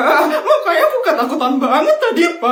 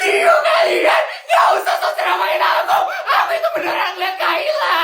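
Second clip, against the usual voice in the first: high tearful yell; crying shout. Acted. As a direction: shout back through tears. Girl defends herself, crying out.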